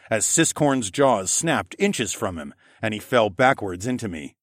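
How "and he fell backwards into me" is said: ordinary delivery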